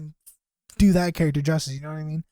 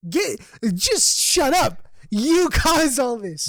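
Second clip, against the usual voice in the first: higher, slow, drawn-out exasperation; exasperated retort; 5 semitones higher, slower than usual. Retold or imitated. Student retelling his reply to a classmate, exasperated retort. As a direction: stretch each word in mock outrage.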